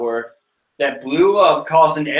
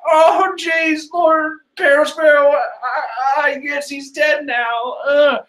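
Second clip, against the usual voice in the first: silly high voice; 11 semitones higher, slower than usual. Acted. Voicing the silly-faced soldier.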